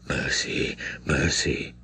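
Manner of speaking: low, soft pleading murmur; barely voiced begging; the priest murmurs to the girl, begging softly. A narrator's reading. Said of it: "murmured the priest".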